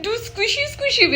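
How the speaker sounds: high, slow, cutesy and half laughing; tearful gushing affection for grandma. Real, not acted. Gushing over grandma on screen.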